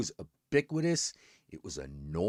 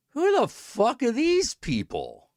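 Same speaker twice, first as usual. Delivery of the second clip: high, drawn-out baffled cry at the screen; baffled outburst; 14 semitones higher, 5 dB louder than usual. Retold or imitated. Viewer yelling at the TV in disbelief. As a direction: talk back to the screen in disbelief. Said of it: "and I'm just like".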